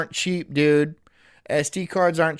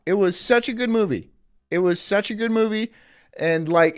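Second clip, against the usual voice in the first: normal talking voice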